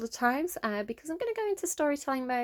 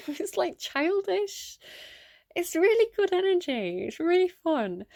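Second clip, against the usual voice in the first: excited; 8 semitones higher, 5 dB louder, slower than usual. Real, not acted. Excited about playful energy.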